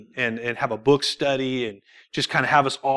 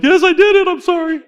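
much higher, blurted guilty confession; panicked confession; 6 dB louder than usual. Retold or imitated. [name] caving under Pastor Al's stare, high blurted confession. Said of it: "I'm like".

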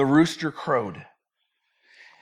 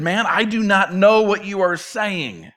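heated, higher, slow denial; really hot; 4 semitones higher than usual. Acted. Peter at his third denial, really hot. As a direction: stretch each word in heated denial. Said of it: "This time Peter really gets hot".

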